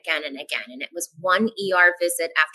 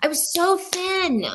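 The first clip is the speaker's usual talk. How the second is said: much higher and slow, ironic; mock boast; 11 semitones higher, slower than usual. Real, not acted. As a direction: stretch it out as a wry joke. Ironic joke about weight loss.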